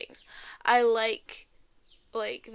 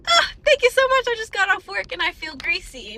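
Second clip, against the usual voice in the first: sweet polite reply; 8 semitones higher, 7 dB louder than usual. Retold or imitated. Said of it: "And I said".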